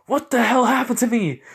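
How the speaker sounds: high, loud shocked cry; shocked outcry. Acted. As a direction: shoot up high in alarm. Venom shocked at own appearance.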